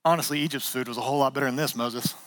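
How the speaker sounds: low, soft, fast whining mumble; mock-whiny grumbler trailing off low; bored grumbling Israelites. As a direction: sulk it out dismissively. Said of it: "and they're like".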